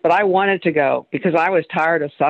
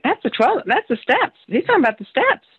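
1 excited recognition; 8 semitones higher than usual. Retold or imitated. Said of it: "and I'm like".

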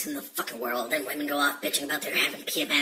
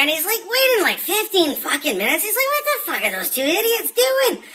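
much higher, louder put-on voice for the whole joke; joke-telling voice; 11 dB louder than usual. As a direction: tell the Scotsmen joke in a high, loud comic voice. Joke told in a held put-on voice.

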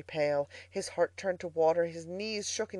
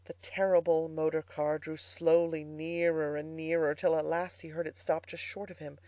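ordinary delivery

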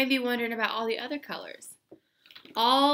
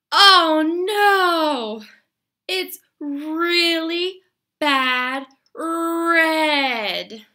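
mock despair; 6 semitones higher, 9 dB louder, much slower than usual. Pretend disappointment at drawing red.

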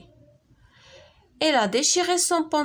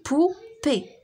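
بوبي in two detached, higher-pitched beats; syllable by syllable; 4 semitones higher than usual. Shown as a demonstration. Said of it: "كنسمعوها في لي دو سيلاب". (بوبي مقطعة على جوج سيلاب)

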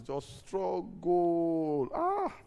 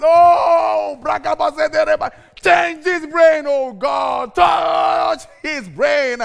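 loud, high, slowed shouted prayer; preaching prayer; 10 semitones higher, 13 dB louder than usual. Pretend. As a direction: pray over the child at full force. Mock prayer over child.

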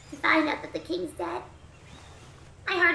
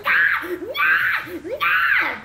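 loud squawking seagull cries; seagull squawks; 5 semitones higher, 5 dB louder, much slower than usual. Retold or imitated. Gamer imitating hangry seagulls, loud squawks. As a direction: squawk like an angry hungry gull. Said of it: "seagulls sound like they're like".

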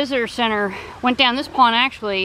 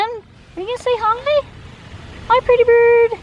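pet talk; 12 semitones higher than usual. Real, not acted. Coaxing the parrot to speak, squeaky high pet-talk voice. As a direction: coax the bird to answer, high and cute.

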